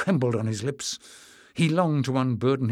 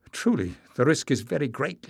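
plain reading voice

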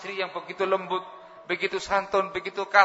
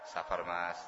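consoling wife; 10 semitones lower, 9 dB quieter than usual. Acted. Wife voiced consoling husband.

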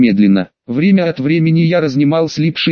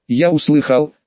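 ordinary delivery

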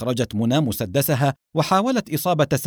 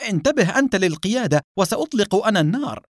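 higher-pitched urgent cry; exclaimed order; 6 semitones higher than usual. A narrator's reading. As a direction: call out to the driver, pitch raised. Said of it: "فصاحت". (صيحة آمرة بنبرة مرتفعة)

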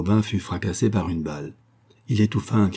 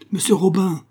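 high, urgent hail; startled high-pitched holler; yelled call; 11 semitones higher, 3 dB louder than usual. A narrator's reading. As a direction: yell out the name, pitched way up. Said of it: "hurla le Parisien".

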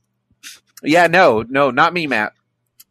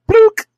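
sucking pop noise; 8 dB louder than usual. Pretend. Pokeball sucking in Pokemon.

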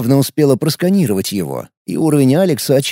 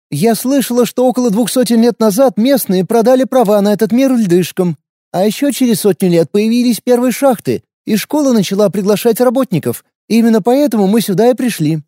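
put-on higher, tough young woman's voice; wary fighter, blunt and guarded; young warrior woman; 8 semitones higher than usual. A narrator's reading. Lina explains.

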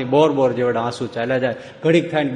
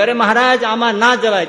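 high, loud pleading cry; desperate call; 10 semitones higher, 5 dB louder than usual. Acted. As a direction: beg him not to go in. Servant pleads at flooded river.

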